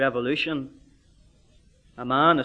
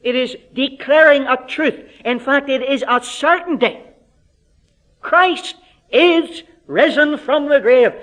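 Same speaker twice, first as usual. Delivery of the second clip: preaching at full force; 8 semitones higher, 7 dB louder than usual. Acted. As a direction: drive the certainty home. Preaching climax.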